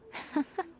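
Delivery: short rising laugh of delight; a quick, sly little laugh; amused chuckle. Acted. Amused at the blunt summary, she laughs.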